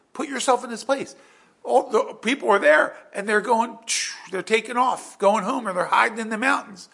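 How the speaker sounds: high, loud, urgent preaching; fired-up sermon build; preaching at full force. Acted. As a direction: drive the scene home to the congregation. Preacher builds the panic scene.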